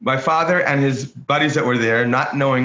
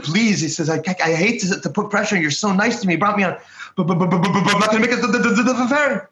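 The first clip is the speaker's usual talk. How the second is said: pleading in a higher voice, stumbling over the buts; the young man begs the old driver, higher and anxious; anxious blurted plea; 6 semitones higher, faster than usual. Retold or imitated. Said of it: "He blurts it out".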